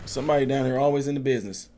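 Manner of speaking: ordinary delivery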